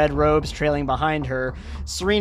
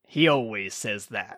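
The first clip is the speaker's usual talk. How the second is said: low, quiet deadpan aside; dry prisoner aside; 5 semitones lower, 4 dB quieter than usual. Acted. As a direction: mutter it under your breath.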